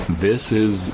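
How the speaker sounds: ordinary delivery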